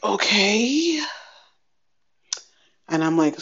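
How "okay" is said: stunned okay. Retold or imitated. Resigned reaction to news.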